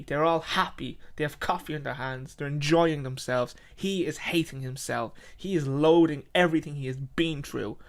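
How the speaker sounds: usual speaking voice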